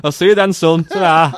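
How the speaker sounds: high, loud sign-off as the in-law; jolly mock father-in-law goodbye; father-in-law role. Signs off as father-in-law, amused.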